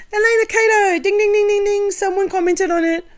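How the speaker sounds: loud, high-pitched bell imitation; high ringing call-out. Mock bell for a commenter's answer.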